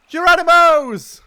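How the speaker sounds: high, loud slow yell; leap-off battle cry; Geronimo yell. Real, not acted. Jumping off in game.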